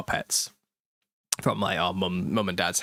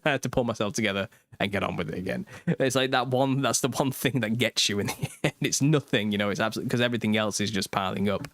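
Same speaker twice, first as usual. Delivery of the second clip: usual speaking voice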